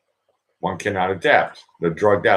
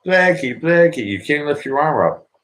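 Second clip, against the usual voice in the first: high, imitated caller; mock pitch from someone selling a fix; somebody's pitch; 8 semitones higher than usual. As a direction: put on a much higher voice for an imagined adviser. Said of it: "If somebody says".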